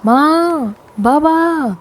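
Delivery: high shouted call for mother and father; shouting for parents. A narrator's reading. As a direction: yell out in alarm, high-pitched. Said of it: "Lakhan जोर से चिल्लाया". (घबराकर ऊँची आवाज़ में माँ बाबा को पुकारना)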